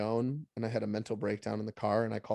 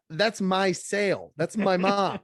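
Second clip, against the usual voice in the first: raised, louder, disbelieving; indignant outburst; 7 semitones higher, 5 dB louder, slower than usual. Real, not acted. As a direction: protest it like you can't believe it. Outraged retelling of trainer's line.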